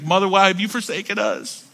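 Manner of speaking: high, hammed-up childish wail; theatrical cry of despair; mock wailing scream. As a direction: wail it like a betrayed kid. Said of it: "We screamed".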